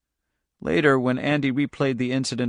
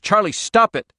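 high, raised command; urgent shout; 9 semitones higher, 4 dB louder than usual. A narrator's reading. Andy to his daughter as cars explode, shouted. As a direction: shout to make the child stop. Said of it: "Andy shouted".

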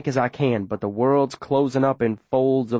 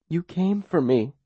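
near tears; 4 semitones higher than usual. Acted. Girl repeating her thanks to the boy, close to crying. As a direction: let the face crumple as you say it. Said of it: "her face crumpling a little".

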